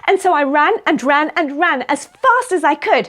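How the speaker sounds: rising to a high, loud finish; excited telling. Acted. Storyteller telling children how she ran to them, excited. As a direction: build eagerly to a high peak.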